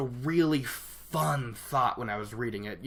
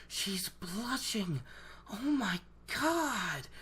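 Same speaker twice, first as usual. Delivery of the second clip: much softer, slow, stunned under the breath; gaping in disbelief, barely voiced; awed hush; 4 semitones higher, 10 dB quieter, much slower than usual. Rand stunned watching Nynaeve blush.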